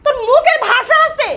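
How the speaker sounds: high, loud outraged challenge; angry shouting. Acted. Sister scolding brother for daring to speak, angry shout. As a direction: hurl it at him loudly.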